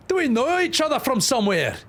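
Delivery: a rough Scottish mum eyeing a stranger; rough Scottish accent. Acted. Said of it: "The mum should be the rough Scottish".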